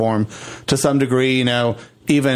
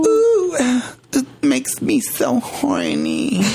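mock aroused whine; 10 semitones higher, much slower than usual.

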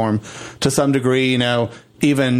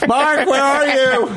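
calling out; 12 semitones higher, slower than usual. Real, not acted. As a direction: shout his name across the house. Calling out for Mark.

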